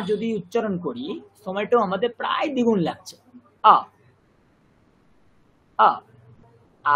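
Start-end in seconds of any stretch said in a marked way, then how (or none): 3.6-7.0 drawn-out আ, held twice as long as অ; long vowel demo. Shown as a demonstration. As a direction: hold আ for double length. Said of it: "কতক্ষণ সময় লাগলো".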